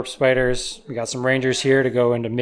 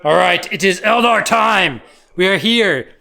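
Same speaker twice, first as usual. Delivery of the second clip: high, loud segment opener; hyped announcement; 5 semitones higher, 5 dB louder than usual. Real, not acted. Player announcing Eldar time to viewers, hyped announcement.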